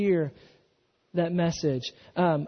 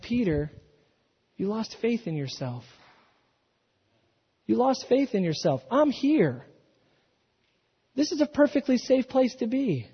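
tender reassurance; 3 semitones higher than usual. Acted. Jesus reassures Peter, quoted.

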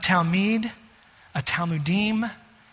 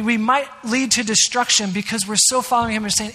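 ordinary talk

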